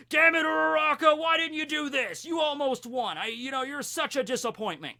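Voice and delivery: loud, high mock scolding from a dad; angry dad. Imagined dad chews out Uraraka over losing, angry mock yell. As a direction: berate your kid in an exaggerated yell.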